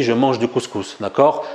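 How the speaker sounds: ordinary talk, nothing marked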